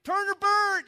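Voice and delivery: hammed-up street preacher yelling at passersby; imitating street preacher yelling. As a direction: bellow it like a corner preacher with a bullhorn. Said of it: "You know, they're just yelling".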